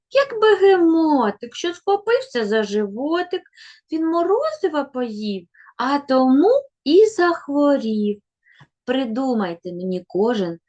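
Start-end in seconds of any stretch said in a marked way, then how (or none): 0.1-8.2 sing-song rhyme, higher and louder; children's rhyme. Shown as a demonstration. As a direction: recite the rhyme brightly to little kids. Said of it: "children's rhyme". (дитячий віршик наспів)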